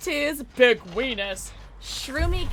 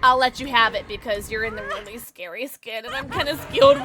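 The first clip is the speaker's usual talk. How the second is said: normal talking voice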